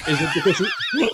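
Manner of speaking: words breaking into a real laugh; can't finish for laughing; laughing mid-joke. Real, not acted. Joking about mom's crash.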